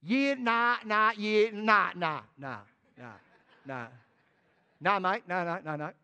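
lightly mocking New Zealand "yeah, nah" refusal; a hint of a Kiwi brushing God off. Kiwi Jonah refusing God's call, mock New Zealand accent. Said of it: "Jonah was a Kiwi".

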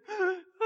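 high hammed-up sobbing gasp; mock sob gasp. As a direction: gasp like a melodramatic crier. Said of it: "people go".

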